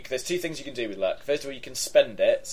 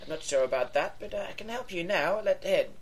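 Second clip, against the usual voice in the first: put-on high, soft old lady voice; mild old woman; 8 semitones higher, 4 dB quieter than usual. Henrietta offering visitors help, mild and high.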